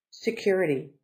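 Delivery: normal talking voice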